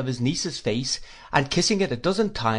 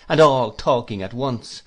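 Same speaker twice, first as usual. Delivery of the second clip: ordinary delivery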